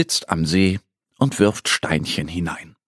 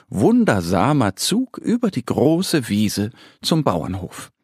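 ordinary delivery, nothing marked

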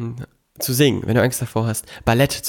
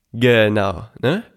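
ordinary talk

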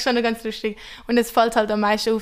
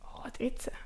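whispered aside; 12 dB quieter than usual. Real, not acted. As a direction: whisper it to yourself. Aside correcting herself.

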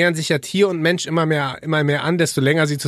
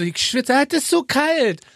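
mock whine; 9 semitones higher, slower than usual. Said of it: "Und dann sag ich so".

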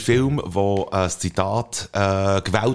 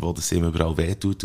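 ordinary talk, nothing marked

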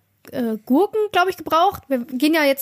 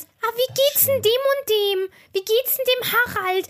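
slow, high mock pensioner asking after someone; old people's small talk; 7 semitones higher, slower than usual. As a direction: imitate nosy old folk, drawing it out. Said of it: "bei alten Leuten immer dieses".

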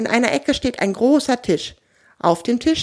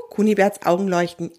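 plain reading voice

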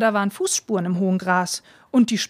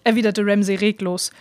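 plain reading voice

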